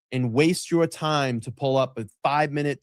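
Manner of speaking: ordinary talk, nothing marked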